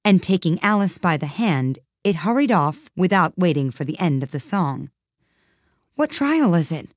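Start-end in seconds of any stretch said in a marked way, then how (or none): 6.0-6.9 out of breath from running; panting. A narrator's reading. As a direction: gasp the question while running. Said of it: "Alice panted as she ran".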